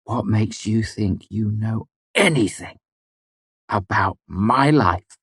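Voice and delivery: slow, tight challenge; furious but controlled. A narrator's reading. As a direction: hold the fury in. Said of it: "her eyes furious".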